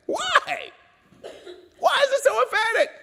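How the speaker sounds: very high, loud shouted question; crying out. Retold or imitated. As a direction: demand an answer at the top of the voice. Quoted question escalates into a cry.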